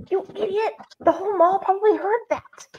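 plain reading voice

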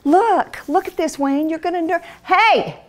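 put-on eager young bride. Young wife showing her husband the verse, high and eager. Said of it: "It sounds so romantic to me".

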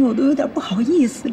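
normal talking voice

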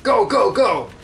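high, loud urgent shout; mock game yell. Quoting the game's influential dialogue, high mock shout. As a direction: yell it like a game character's battle cry. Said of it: "influential dialogue, such as".

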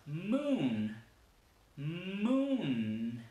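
"Moon" with M and N hummed long; stretched English "Moon", buzzing at each end. Shown as a demonstration. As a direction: linger on both consonants of "Moon". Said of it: "son larguísimas".

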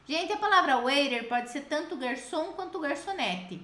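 ordinary talk, nothing marked

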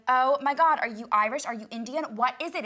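loud, starstruck mock gush; excited gushing. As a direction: blurt it out like a fan. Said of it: "and I'm like".

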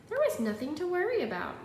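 a mother's cheerful told-you-so. Acted.

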